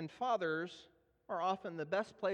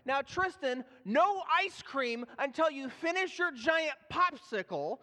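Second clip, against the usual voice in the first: lecturing dad voice, a bit raised; stern dad scolding; 6 semitones higher, 3 dB louder than usual. Retold or imitated. Dad scolding his son about the popsicle, mock-stern. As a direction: lay down the rule like a strict father. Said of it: "I found myself scolding my son".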